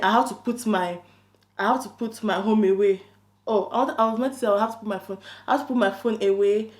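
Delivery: normal talking voice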